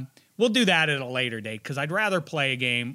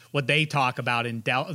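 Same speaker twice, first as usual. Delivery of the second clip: ordinary delivery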